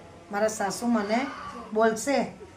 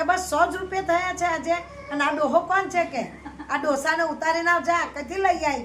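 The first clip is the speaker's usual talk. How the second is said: harsh scold; 6 semitones higher than usual. Acted. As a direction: sneer at the stranger she brought home. Mother-in-law scolds over old man.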